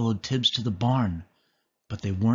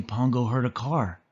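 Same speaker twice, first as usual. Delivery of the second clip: usual speaking voice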